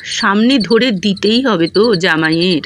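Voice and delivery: ordinary talk, nothing marked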